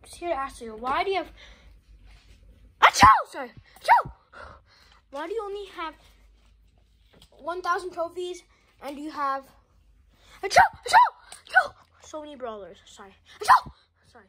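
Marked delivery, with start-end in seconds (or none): 2.8-4.1 high loud mock sneezes; hammed-up fake sneezing. Fake sneezing at his sister. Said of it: "fake sneeze". 13.4-13.7 high loud mock sneeze; hammed-up fake sneeze. Fake sneezing at his sister. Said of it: "fake sneeze".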